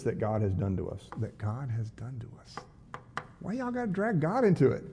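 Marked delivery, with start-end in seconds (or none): 1.2-2.6 much softer repeat of the verse; hushed pointed echo. Retold or imitated. Pastor repeats the brothers' words to question them, hushed pointed echo. Repeats read line as aside. 3.4-4.8 high-pitched, exasperated objection; mock exasperation. Pastor objects to the brothers blaming God, mock exasperation.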